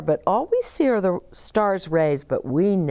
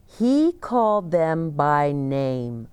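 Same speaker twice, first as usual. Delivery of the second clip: ordinary delivery, nothing marked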